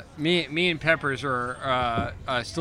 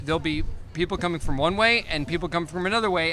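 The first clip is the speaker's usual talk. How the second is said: ordinary talk, nothing marked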